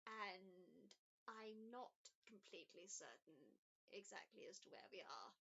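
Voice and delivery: a hint of a kindly, unsure old woman; elderly woman. GM voices Elizabeth again.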